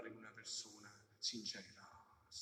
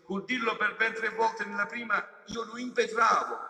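preaching at full force; 7 semitones higher, 11 dB louder than usual. Acted. Preacher rebuking hypocrisy before the faithful, full-force preaching. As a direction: rouse the congregation, calling them out.

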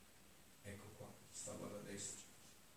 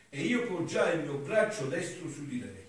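raised, loud declaiming voice; higher-pitched oratorical reading aloud; solemn devotional reading; 6 semitones higher, 11 dB louder than usual. Acted. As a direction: declaim the sacred text with fervour. Reads devotional text aloud.

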